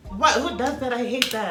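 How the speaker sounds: loud, high burst of disgust; I hate that, shouted high; outraged shout. Real, not acted. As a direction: yell it in outrage. Outraged reaction to hair grabbers.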